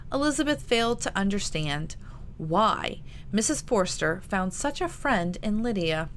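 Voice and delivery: plain reading voice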